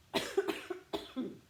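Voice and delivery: sudden hard cough, something down the wrong way; coughing fit. Real, not acted. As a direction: cough as a drink goes down the wrong way. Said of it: "I can't have a coughing fit".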